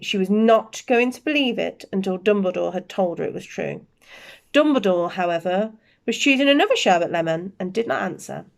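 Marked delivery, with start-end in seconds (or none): none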